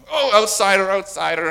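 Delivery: loud, high, over-eager mob cry; hammed-up shout, high and loud; mock alarm call. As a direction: imitate a crowd pouncing on a newcomer. Mimics swarming a newcomer.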